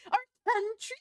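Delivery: high, loud, rising disbelief; incredulous. Real, not acted. Streamer repeating the politician's word back in disbelief, incredulous. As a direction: squeal it back as a question.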